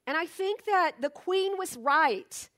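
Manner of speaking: usual speaking voice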